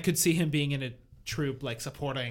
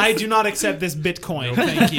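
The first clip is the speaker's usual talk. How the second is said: laughing; 5 semitones higher, 7 dB louder than usual. Real, not acted. Laughing at the bit-bus joke.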